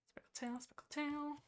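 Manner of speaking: hushed, whispery name repetition; murmuring while searching. Real, not acted. Searching notes, murmuring to herself.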